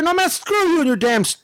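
high-pitched indignant insults; retold tirade. Customer swearing at taco worker, pissed-off tirade. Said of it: "he hears my tirade".

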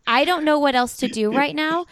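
exasperated. Retold or imitated. Mom retelling her frustration with her son, exasperated. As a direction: throw up your hands in frustration. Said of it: "I was like".

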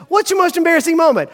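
lightly mimicked nosy asker; mock quizzing voice. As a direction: put the dreaded question on the spot. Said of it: "It's uncomfortable to be asked the question".

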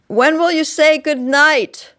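put-on high, loud child's question; child calling out. A narrator's reading. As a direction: call down to a parent, pleading. Child calls down to parent.

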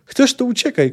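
high, loud contemptuous shout. A narrator's reading. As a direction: yell it at her scornfully. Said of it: "krzyknęła". (głośny, pogardliwy krzyk)

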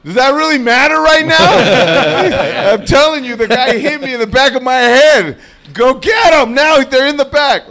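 high-pitched mock outburst; acted indignant yelling; exasperated protest. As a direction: plead loudly with useless cops, hammed up. Exasperated retold argument with police.

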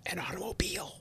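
breathy stage whisper aside. Acted. Host whispering a joke aside to co-host, hushed. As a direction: drop to a secret whisper for the punchline. Joking aside, listener laughs.

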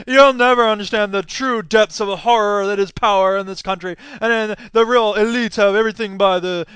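loud, overwrought coffeehouse cynic; hammed-up doom from a pretentious theory student; disaffected intellectual. Espresso-drinking critical theory type lecturing him, mock despairing. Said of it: "sort of disaffected people who smoked clove cigarettes".

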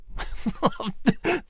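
chuckle. Acted. Eagle chuckling.

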